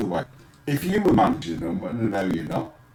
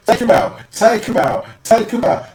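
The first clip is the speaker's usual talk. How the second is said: raised, louder repeated command; mock urging; 6 semitones higher, 5 dB louder than usual. Fan urging a tackle on his favourite player, mock urging. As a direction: egg them on, high and loud.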